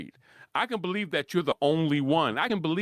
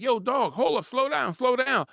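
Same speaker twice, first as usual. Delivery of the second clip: urging restraint; 8 semitones higher than usual. Retold or imitated. Quotes own warning to fans.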